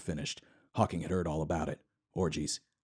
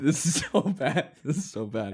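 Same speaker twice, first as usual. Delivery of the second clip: high, slow, laughing "so bad"; laughing groan; 7 semitones higher than usual. Real, not acted. Reader laughing at own story, high and stretched. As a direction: wince through a laugh.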